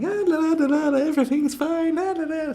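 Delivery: very high, loud sing-song la la la; mock carefree singsong. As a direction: skip along like a blissfully oblivious fool. Mocking the happy extreme.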